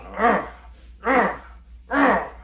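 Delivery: high grunts of effort in short bursts; mock straining grunts. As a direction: imitate someone shoving with all his might. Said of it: "he's huffing and puffing. He's going".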